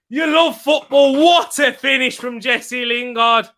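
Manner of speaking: high, slow, stretched commentary call; big excited football commentary; excited play-by-play. Real, not acted. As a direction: relive the goal like a match commentator. Live play-by-play of the goal.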